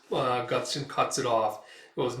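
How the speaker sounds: usual speaking voice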